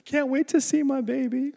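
a hint of a high, eager mother; lightly voiced young mother. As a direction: sing it out like a happy new mom. Mother voiced before finding swap.

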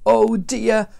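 acted storybook misery; woeful groan. A narrator's reading. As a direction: groan it out in dismay. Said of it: "Jonah groaned".

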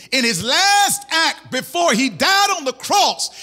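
full-voiced preaching, rising on key phrases; impassioned preaching. Real, not acted. Preacher urging congregation to repent, impassioned. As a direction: drive the message home to the congregation.

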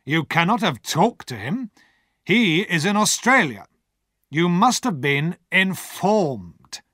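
a hint of a stern, careful lawyer; warning lawyer. A narrator's reading. Lawyer warning Pip, measured and stern. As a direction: warn him, slow and measured. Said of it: "Mister Jaggers warned me".

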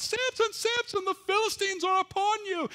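high, loud cry of alarm; yelled alarm. Acted. Wife yelling to wake Samson, loud and high-pitched. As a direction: shout his name as an alarm. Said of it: "she yelled out".

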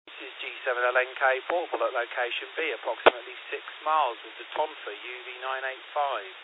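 tinny radio playback; over the radio. Shown as a demonstration. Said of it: "here we are with the Tonfa".

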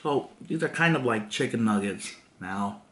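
chewing while talking; talking between bites of food; mouth partly full. Real, not acted. Seen 1: Holds bitten nugget; closed, working lips early, later mouth clear.

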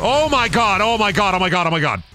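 high, frantic shouting; high cries tumbling over each other; real panicked yelling. Real, not acted. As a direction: panic at a sudden attack. Sudden attack by escaped convict in game.

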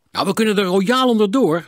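hoog en luid, vol zelfvertrouwen; opgewekte inschatting. A narrator's reading. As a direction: roep het monter uit, hoog en luid. Personage schat hardop in. (enthousiast, luid en hoog)

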